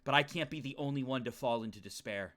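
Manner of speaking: low, soft dramatic reading; hushed, low self-talk; somber inner monologue. Acted. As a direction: read it as troubled private thoughts, pitched low. Reads the character's inner monologue.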